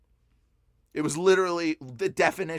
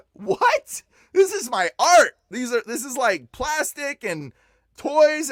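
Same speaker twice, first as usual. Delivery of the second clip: high, incredulous objection; re-enacted dismay, pitch shooting up; retold shocked protest; 8 semitones higher, 6 dB louder, slower than usual. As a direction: protest in disbelief, leaning hard on key words. Protests command to burn art.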